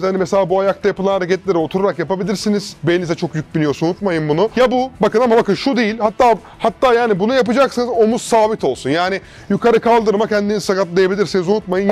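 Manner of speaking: ordinary delivery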